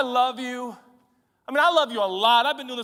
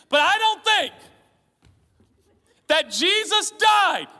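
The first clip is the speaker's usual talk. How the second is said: higher, louder, slow shouted preaching; full-force preaching; 10 semitones higher, 5 dB louder, slower than usual. Acted. Preacher shouting at the congregation about big plans. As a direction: drive each word home from the pulpit.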